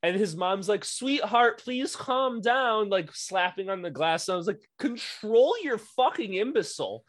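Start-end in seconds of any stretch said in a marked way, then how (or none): 0.8-2.9 high, slow, sugary plea; pleading hockey mom. Retold or imitated. Mimicking the mom slapping the glass to calm her son, high and slow. 4.8-7.0 high, loud disbelieving rant; exasperated outburst. Retold or imitated. Coach venting at the passive mom, pitched up and loud.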